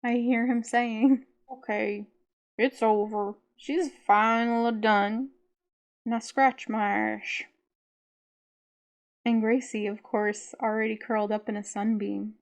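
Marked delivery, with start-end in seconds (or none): 1.5-7.4 louder, slow drawl, syllables dragged out; the lazy old dog, relieved and demanding; lazy Southern drawl. Retold or imitated. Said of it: "lopsided accent she made just for him".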